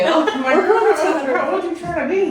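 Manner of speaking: high, loud, laughing exclamation; laughing disbelief. Real, not acted. Guest teasing host about seven hundred years, loud laughing disbelief. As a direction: cry it out loudly through laughter.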